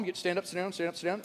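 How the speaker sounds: mock routine, dropped right down; muttered routine drill. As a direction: mumble it as a joking aside. Joking mock liturgy instructions.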